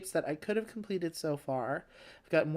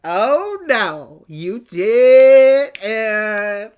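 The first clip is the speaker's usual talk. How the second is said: loud, high, drawn-out indignant retort; mock outrage; 4 semitones higher, 18 dB louder, much slower than usual. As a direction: blow up in hammed-up offense, stretching the words out. Acted outraged retort to the letter.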